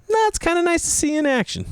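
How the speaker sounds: very high-pitched friend caricature; mock easygoing reassurance. Golf friend reassuring the embarrassed comedian, mock easygoing. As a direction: wave it off in a high put-on voice.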